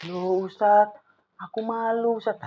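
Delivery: a light, mock-shy child's whine; shy student. Teacher voicing a shy pupil, mock-bashful. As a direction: voice a pupil too embarrassed to speak.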